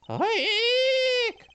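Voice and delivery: very high held squeal; high shriek. Acted. Reacting to the find up top, high shriek.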